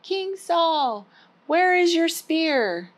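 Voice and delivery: high, slow call across the camp. Acted. David calling to King Saul in the morning, high call. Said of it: "he called out".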